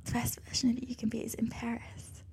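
whispered comeback. Acted. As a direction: answer in a teasing whisper. Playful whispered reply.

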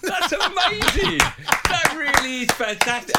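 high, slow, laughing delight; laughing praise. Real, not acted. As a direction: gush over the impression. Host gushing after impression.

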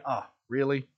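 low, dry "Oh, really?"; a lightly voiced unimpressed Mary; mock appalled Mother. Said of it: "She had to be watching, going".

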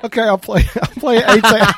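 loud, high surrender breaking into laughter; giving in, laughing. Real, not acted. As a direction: give in while cracking up. Gives in, cracking up, in role play.

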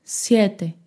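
usual speaking voice

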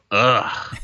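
short pained ugh groan; a grunt that stands in for tears; groan of dismay. Retold or imitated. Describing how he cries at sad videos, a pained ugh groan. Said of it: "that, that's, like, me crying".

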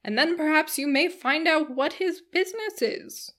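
high, loud, eager busybody; excited matron character voice; prying matron. A narrator's reading. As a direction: urge him on with nosy relish. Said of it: "cried Missus Jennings".

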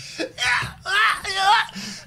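high, shrieking burst of laughter; laughing. Real, not acted. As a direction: crack up at the clip. Laughing at clip.

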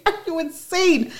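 imitating her disbelief at his accusation; incredulous. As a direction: throw it back in mock shock. Retold disbelief at him.

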